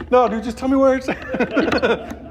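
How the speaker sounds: very high, slow, laughing whine; laughing mock plea. Laughing as he begs the punk for the address. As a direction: beg through a laugh.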